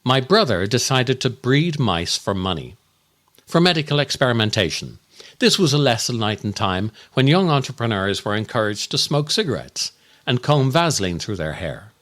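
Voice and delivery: ordinary talk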